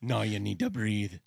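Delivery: mock Quint accent; gruff Quint impression. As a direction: growl it like a salty old shark hunter. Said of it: "accent turned into".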